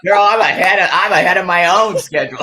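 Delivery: loud, high, laughing exclamation; laughing joke. Real, not acted. Joking to the host about her alarm, loud laughing. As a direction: crack up at your own joke.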